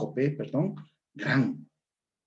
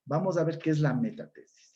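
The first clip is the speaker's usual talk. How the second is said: ordinary talk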